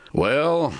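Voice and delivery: hesitant well. A narrator's reading. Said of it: "Timber hesitated".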